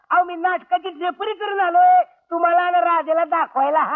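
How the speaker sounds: ordinary talk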